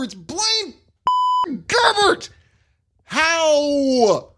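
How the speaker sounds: high, loud disbelief; ranting pundit in disbelief; incredulous outburst. Real, not acted. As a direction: throw up your hands at the name. Incredulous outburst about signing.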